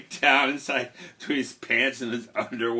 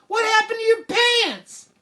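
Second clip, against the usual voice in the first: very high, loud exasperated mom; scolding mother; 5 dB louder than usual. Acted. Mother scolding Jack over wet pants, exasperated. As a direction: screech at your son in mock dismay.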